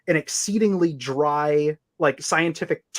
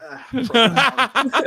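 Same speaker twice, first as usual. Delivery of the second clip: mock profound; 9 semitones higher, 5 dB louder, much slower than usual. Mock praise after reading.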